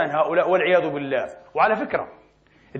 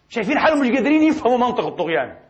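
ordinary talk, nothing marked

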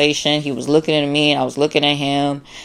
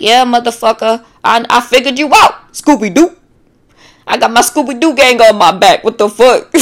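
high, loud gloating, much higher; taunting triumph; 8 semitones higher, 5 dB louder than usual. Retold or imitated. Victim gloating at the narcissist, high and loud. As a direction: crow that you caught them out. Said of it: "And it's like".

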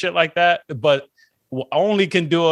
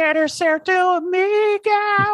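high, drawn-out mock voice; exaggerated quote; 12 semitones higher, much slower than usual. Comic teasing his co-host with a quote, exaggerated high voice. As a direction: stretch it out way up high.

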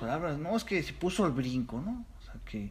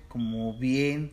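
slow cool street drawl; much slower than usual. Shown as a demonstration. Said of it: "¿Chido?".

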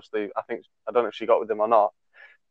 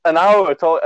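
high, flustered protest; flustered denial; 6 semitones higher, 3 dB louder than usual. Retold or imitated. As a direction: deny it in a rising fluster. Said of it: "And I was like".

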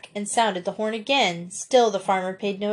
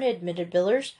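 usual speaking voice

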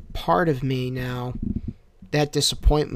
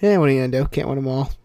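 usual speaking voice

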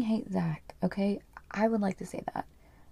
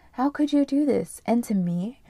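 higher, louder acted reproach; hurt accusation; 4 semitones higher, 5 dB louder than usual. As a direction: confront a husband who betrayed you. Wife confronts accused husband.

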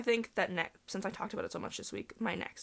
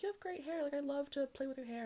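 gushing friends; 7 semitones higher, 5 dB quieter than usual. Retold or imitated. Friends gushing over her hair.

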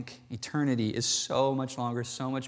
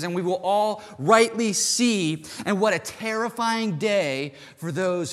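higher and louder, slowing on the heavy words; full-force preaching; 6 semitones higher, 5 dB louder than usual. Acted. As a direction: preach it out, building to a climax. Preacher climax about judgment day.

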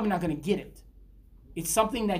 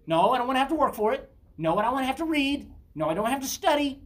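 whiny mock demand; 6 semitones higher, 4 dB louder than usual. Mocking lazy believers' demands.

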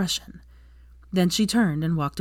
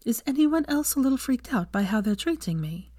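higher-pitched, incredulous question; disbelief; 7 semitones higher than usual. A narrator's reading. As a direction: ask it in a lifted voice of disbelief. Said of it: "Hermione asked in disbelief".

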